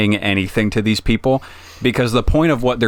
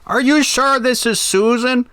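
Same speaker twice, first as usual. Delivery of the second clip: put-on much higher pitched sweet old lady. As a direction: answer the phone as a little old lady. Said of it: "like, an old lady voice".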